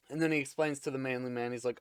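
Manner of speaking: ordinary talk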